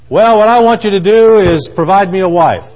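loud, high, hammed-up demand; acted hearty request. Imagined blind man asks Jesus, hammed-up and loud. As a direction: belt out a mock wish.

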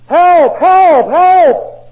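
high, very loud screams for help; trucker screaming. Retold or imitated. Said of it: "The trucker screamed out".

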